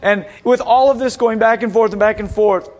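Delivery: ordinary talk, nothing marked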